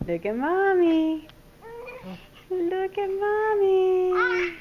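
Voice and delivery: slow, high, stretched "Mommy"; drawn-out call. Real, not acted. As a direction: stretch it out to pull his gaze. Mom drawing out call to baby.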